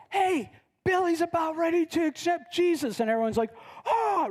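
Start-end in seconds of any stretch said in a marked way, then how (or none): 0.1-3.0 high, urgent call to the crowd; excited announcement. Acted. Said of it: "someone comes running in and says". 3.8-4.3 high-pitched crowd gasp; excited gasp. Acted. Crowd reacts to the news.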